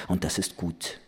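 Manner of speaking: low, soft laconic close; understated and dry, voice sinking. A narrator's reading. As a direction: drop it quietly, without fuss. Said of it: "sagte sie lakonisch".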